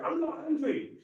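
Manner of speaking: high loud huffy I'm not angry; mock angry denial. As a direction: deny being angry while clearly angry. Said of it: "you don't need to be angry".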